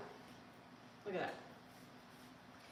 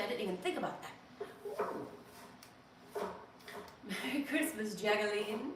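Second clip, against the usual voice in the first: ordinary delivery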